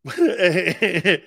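loud burst of chuckles; big rolling laugh; real loud laugh. Real, not acted. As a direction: laugh out hard at your own story. Keeps laughing at his story.

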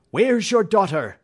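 loud, high challenge; angry demand. Acted. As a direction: demand an answer from the villain. Holmes confronts the father.

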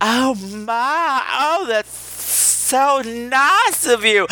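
high, drawn-out gushing affectation; Bradford gone weird. Retold or imitated. Friend flattered by a stranger's praise, affected gushing. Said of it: "Bradford turned into some weird".

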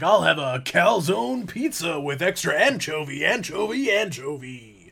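monster truck announcer. Acted. Announcer phoning the pizza place, hammed-up hype voice. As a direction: hype the order, punching each repeat. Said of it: "It's like he phones the pizza place".

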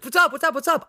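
high, bubbly repeated greeting; imitating a friend's greeting. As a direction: chirp it like an eager friend. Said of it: "Sam, you're always like".

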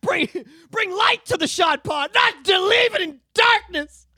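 slow, high, loud movie-villain cry; mock anguished yell; hammed-up dramatic shout. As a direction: drag it out like a tragic hero. Continues mock Star Wars scene.

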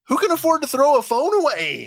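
loud, very high-pitched disbelief; incredulous outburst. Real, not acted. Host baffled by the phone thrower, loud incredulous cry. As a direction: exclaim it, high and outraged.

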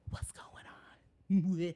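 eerie spooky whisper. Acted. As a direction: whisper it like a ghost story. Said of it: "Quit doing that".

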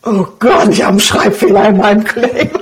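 retold dismay dissolving into laughter; laughing shock. Telling an interviewer about her typo, laughing at herself. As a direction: laugh through the horrified realisation. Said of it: "Und ich drehe mich nur so um".

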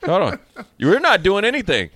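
normal talking voice

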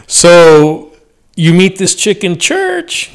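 ordinary talk, nothing marked

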